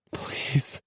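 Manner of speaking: breathy pleading whisper; desperate whisper. A narrator's reading. Man begging the woman leaving him, whispered plea. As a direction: whisper it, begging her not to leave.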